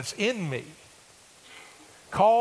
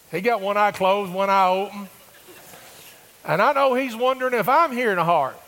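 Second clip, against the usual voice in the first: excited storytelling; 6 semitones higher, 6 dB louder than usual. Real, not acted.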